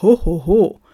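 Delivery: loud, booming ho-ho-ho; Santa laugh. Acted. Santa corrects his own laugh, hearty ho-ho-ho. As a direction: boom out each ho separately.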